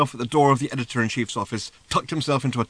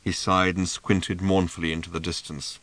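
ordinary delivery, nothing marked

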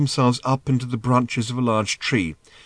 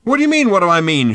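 high, loud shouted retort; exasperated shout; 9 semitones higher, 8 dB louder, faster than usual. A narrator's reading. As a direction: throw the question back at full volume. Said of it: "shouted Ford".

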